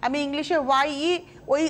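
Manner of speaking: normal talking voice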